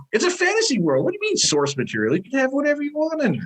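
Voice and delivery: high-pitched incredulous rebuttal; exasperated retort. Acted. Fan answering purists about Tolkien source material, exasperated. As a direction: argue back, pitch leaping in disbelief.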